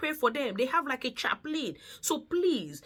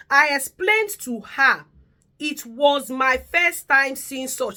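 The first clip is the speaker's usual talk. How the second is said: slow, louder, each word weighed; slow emphatic read; 3 semitones higher, 9 dB louder, slower than usual. Shown as a demonstration. Reading the carer's message to viewers, slow and louder. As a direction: read it out slowly and heavily, as if dictating.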